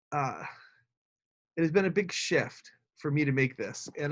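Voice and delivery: usual speaking voice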